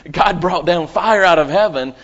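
loud, rising preacher's build; preaching fervor. Real, not acted.

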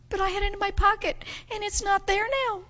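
put-on high, tearful child voice; mock crying. Upset girl explaining the empty pocket, high imitated crying. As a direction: whine it like an upset little girl. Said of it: "and she started crying".

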